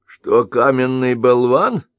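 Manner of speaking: slow sneering question, болван rising; mock the word болван; unkind sneer. Merchant sneering at the Kagan's words. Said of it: "недобро усмехнулся".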